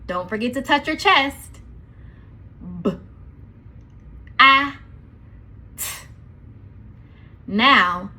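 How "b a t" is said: each letter's sound said separately; sounding out words. Shown as a demonstration. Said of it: "let's sound out each letter".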